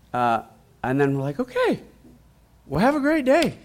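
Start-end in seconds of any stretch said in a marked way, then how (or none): 1.4-3.6 very high, singsong goodbye; high-pitched mock send-off; retold cheery sign-off. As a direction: imitate a polite, chirpy farewell. Retold cheery parting to strangers.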